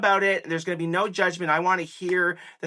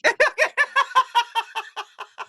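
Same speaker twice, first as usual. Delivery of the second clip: bright, high burst of laughter; 5 dB louder than usual. Real, not acted. Laughing as the host finally asks him the billboard question, high and bubbly.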